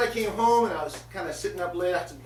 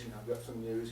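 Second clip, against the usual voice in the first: a hint of a gentle host, low and soft; speaking softly; 7 semitones lower, 12 dB quieter, faster than usual. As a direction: break the news softly. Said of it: "He's kinda speaking to her".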